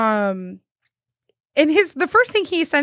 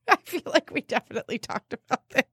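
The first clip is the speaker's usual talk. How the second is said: laughing through the words; 8 semitones higher than usual. Real, not acted. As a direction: giggle while saying it. Laughing while recalling story.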